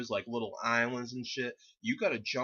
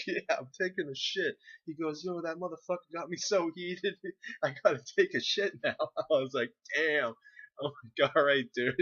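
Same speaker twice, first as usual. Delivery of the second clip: higher, amused, with laughs breaking in; laughing retelling; 6 semitones higher, 4 dB louder than usual. Real, not acted. Retelling the friend's confession behind the boulder, laughing. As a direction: crack up while acting out both sides.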